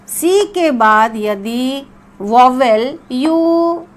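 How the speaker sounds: teacher stresses an important rule, very slowly; slow emphatic dictation. Shown as a demonstration. Teacher to class on a key rule, slow emphasis. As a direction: spell it out word by word. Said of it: "बहुत ही महत्वपूर्ण".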